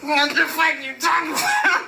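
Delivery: mock outraged yell; panicked kid yelling. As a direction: shout back like a drowning child. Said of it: "just like".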